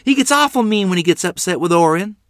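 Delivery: high, loud, alarmed plea; crying out. A narrator's reading. Sister warning mother about father, crying out. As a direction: warn her urgently. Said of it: "Lottie cried".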